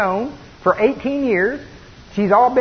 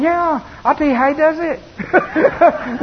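acted reply; 8 semitones higher, slower than usual. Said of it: "And he's going".

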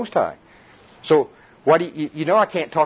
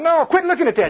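high, loud hammed-up devil; mocking devil voice; 3 dB louder than usual. Devil pulling Louis away from the word, high, loud mocking voice. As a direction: wheedle like a pestering devil. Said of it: "the devil's going".

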